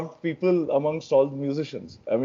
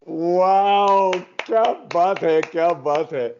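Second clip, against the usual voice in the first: excited; 4 semitones higher, much slower than usual. Real, not acted. Praising the performance.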